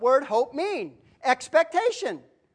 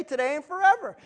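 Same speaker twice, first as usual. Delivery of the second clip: ordinary delivery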